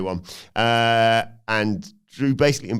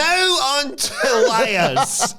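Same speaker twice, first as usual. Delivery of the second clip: high, slow mocking whine; mock whiny complainer; 4 dB louder, much slower than usual. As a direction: whine out a list of grievances like a sulking wrestler.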